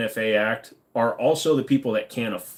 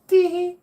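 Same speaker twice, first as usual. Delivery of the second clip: high, louder hammed-up giggle; a coy fake titter; mock tee-hee giggle; 5 dB louder than usual. Streamer teasing a viewer after a crude joke, mock giggle.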